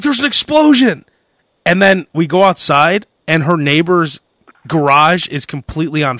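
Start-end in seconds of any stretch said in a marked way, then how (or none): 0.0-1.0 alarmed report. Retold or imitated. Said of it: "She's like".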